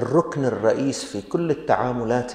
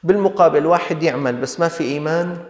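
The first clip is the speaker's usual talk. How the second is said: louder, higher forceful point; emphatic preaching; 4 semitones higher, 5 dB louder than usual. Real, not acted. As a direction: drive the contrast home. Preacher raises voice to stress. (وعظ بصوت مرتفع وحازم)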